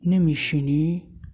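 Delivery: a lightly deepened husband's voice, unhurried; thick manly voice. Acted. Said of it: "با صدای کلفت و مردونش گفت".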